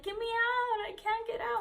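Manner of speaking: very high, mock-desperate wail; squealed re-enactment of panic; crying plea. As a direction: imitate a crying kid begging for rescue. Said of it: "and I was crying".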